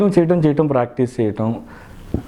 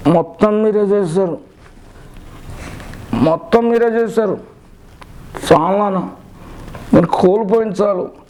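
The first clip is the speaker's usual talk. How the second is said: Siddharth; 8 semitones higher than usual. Acted. Son blames father in film climax, pained high reproach. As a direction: stretch each accusation out, pausing between. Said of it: "Siddhartha గారు climax dialogue".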